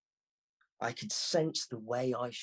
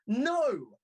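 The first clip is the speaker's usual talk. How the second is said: high, loud triumphant cry; girl crowing over her win; gleeful no; 11 semitones higher, 7 dB louder than usual. Acted. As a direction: shout it in delight. Girl gleefully rejects guess.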